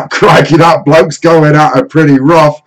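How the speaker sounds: loud, high, excited Aussie showman; Steve Irwin. Retold or imitated. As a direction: bellow it like a croc hunter. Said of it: "Steve Irwin".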